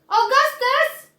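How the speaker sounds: high, loud drawn-out call. Acted. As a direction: call the boy back sharply. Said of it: "called out Missus Gloop".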